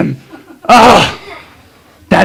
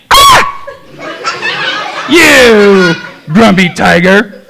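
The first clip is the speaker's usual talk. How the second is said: bursting shout; 2 semitones higher, much slower than usual. Acted. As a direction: burst in yelling at the tiger. Ghost bursts in on tiger.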